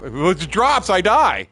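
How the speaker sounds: high, loud panicked objection; retold frantic shout; alarmed protest. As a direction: raise your voice as if your life depends on it. Retells protest in crane argument.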